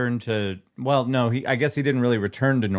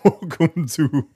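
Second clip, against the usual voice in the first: Santa voice; slower than usual. Acted. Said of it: "What does Santa sound like?".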